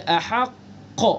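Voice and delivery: slow ahaqq with a held, bounced qaf; strongest qalqalah on qaf. Shown as a demonstration. Teacher repeating the example, strong echoing qaf in ahaqq. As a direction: close on the qaf, then pop it open. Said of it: "سب سے زیادہ اس کے اندر قلقلہ".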